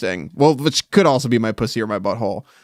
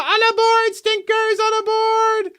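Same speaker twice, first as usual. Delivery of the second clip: train conductor call; slower than usual. Pretend.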